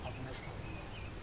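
slow breathy whisper to himself; husband whispering after slapping the boss; whispered regret. Acted. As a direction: breathe it out in defeat. Husband realises mistake.

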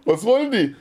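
high-pitched alarmed question; mock panic, pitched well up; retold alarm. Artist reacts to the Naples club calling, alarmed.

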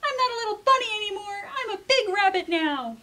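high, fast, loud with a whiny cry; indignant kid rabbit. Acted. Jasper tells Mom he is a big rabbit now, high and whiny. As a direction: stamp your foot and whine it out.

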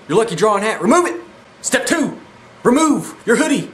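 announcer voice. Acted. Announcing drawing-mode steps.

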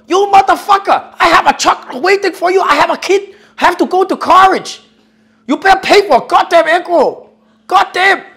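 a hammed-up furious prank caller with a Chinese accent; mock angry Chinese accent. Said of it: "I wanna say that I'm Chinese".

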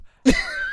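squealing high-pitched laugh. Real, not acted. Laughing at his own Scouse attempt, a high squeal. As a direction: burst into a shrill giggle.

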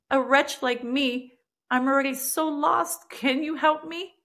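rising desperate plea for rescue; tearful pleading prayer. Retold or imitated. Repenting to God in the cell, pleading through tears.

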